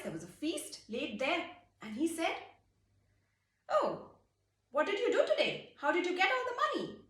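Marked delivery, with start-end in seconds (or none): 3.7-7.0 high, quick amazed questions; surprised king. Acted. King finding a feast laid out, high surprise. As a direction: marvel at the feast.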